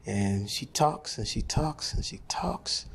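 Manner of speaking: trailing off mockingly; fading repetition. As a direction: let and she talks fade away into a whisper. She talks on and on.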